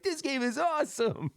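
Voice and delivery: high, laughing mock gush over a good game; gushing gamer. Player after a good game, laughing excited gush. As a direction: squeal it like you just had a great game. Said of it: "you're like".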